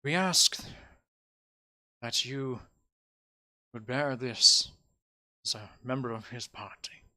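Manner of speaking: halting, lightly voiced formal request; solemn mayor. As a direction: ask it gently, pausing between phrases. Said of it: "he looks towards you and he says".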